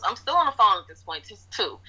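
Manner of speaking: ordinary delivery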